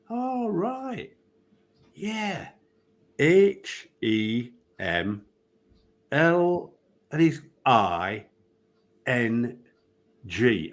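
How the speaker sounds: slow, deliberate spelling of the name; mock patient spelling. Referee mockingly spelling out Emlyn Hughes's name slowly. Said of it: "And I go".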